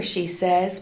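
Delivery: plain reading voice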